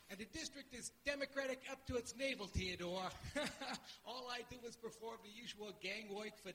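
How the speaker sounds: put-on old New York political boss in Brooklynese; Brooklyn accent. Shown as a demonstration. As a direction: lecture in a thick Brooklyn accent. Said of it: "It's, it's Brooklyn".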